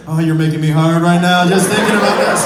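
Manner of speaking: hammed-up reply to an eager buddy; mock aroused. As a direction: push it out low and loud, playing turned on. Acted reply to his eager buddy.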